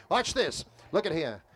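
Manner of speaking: sideshow barker. Shown as a demonstration. Sideshow talker building a tip on Coney Island, barking. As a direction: reel the passersby in with a brisk barker call. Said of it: "I'm gonna build a tip like I would normally".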